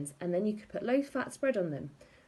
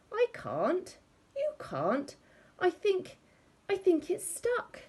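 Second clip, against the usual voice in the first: high, strained, pained pleading; panicky whine; 12 semitones higher, slower than usual. A narrator's reading. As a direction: squeak it out like a man in trouble. Stuck husband in pain.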